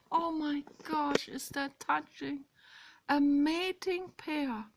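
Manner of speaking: high-pitched, touched delight; delighted gushing. Real, not acted. Woman spotting a mating pair of birds, gushing. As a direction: gush over a sweet sight.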